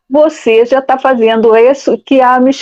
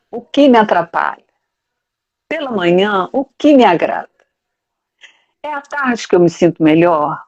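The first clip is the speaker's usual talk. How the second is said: reflective guiding voice; 3 dB quieter than usual. Acted. Speaker guiding listeners to question their mornings, softly. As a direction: lead them inward with gentle questions.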